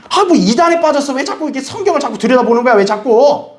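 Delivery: high, hammed-up nagging; exasperated accusation. Family suspecting a cult over Bible reading, exasperated accusation. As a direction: nag accusingly, starting high and easing down. Acting out suspicious family pressure.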